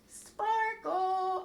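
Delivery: high, loud calling out to a puppet. Real, not acted. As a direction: call the puppet out to join.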